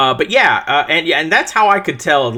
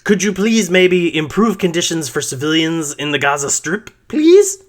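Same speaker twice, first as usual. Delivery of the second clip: mock timid plea; 4 semitones higher than usual. Mocking the US asking Israel nicely, sarcastic plea. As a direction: beg meekly, mocking the weak demand. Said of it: "We're working towards".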